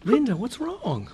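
slow, soft, alarmed question; worried, hushed. Acted. Husband finding wife after overdose, soft worried question. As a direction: ask gently, afraid of the answer.

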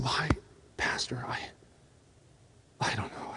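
halting near-whisper, barely audible; reluctant, very soft stammered answer; hesitant. Retold or imitated. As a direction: falter and trail into a murmur, low and hushed. Ashamed Dave answers the pastor.

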